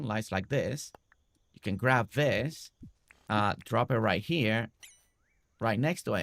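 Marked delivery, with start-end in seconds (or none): none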